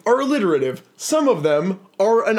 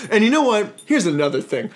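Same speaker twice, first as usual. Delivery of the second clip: usual speaking voice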